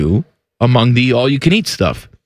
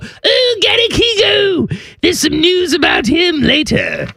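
very high, hammed-up squeal; silly gossip voice. Host teasing news about a golfer, silly high voice. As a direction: coo it far up in pitch.

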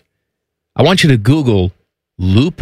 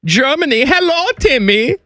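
put-on playful German accent, high-pitched; 13 semitones higher than usual. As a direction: ham up a thick German accent, higher. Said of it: "if you keep speaking in a German accent".

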